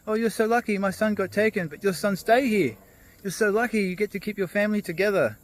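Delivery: high, eager, gushing voice; excited neighbour. Retold or imitated. Rushing neighbour congratulating the farmer, high and excited. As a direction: pour out envy and congratulations. Neighbour rushes over, envious.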